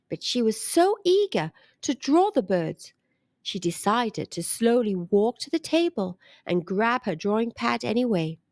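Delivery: plain reading voice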